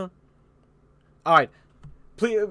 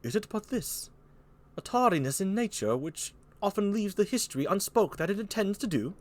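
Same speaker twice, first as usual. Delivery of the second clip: put-on soft British accent, slightly raised; France; 5 semitones higher, 4 dB quieter than usual. France questioning Lear, soft British accent. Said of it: "Here's France".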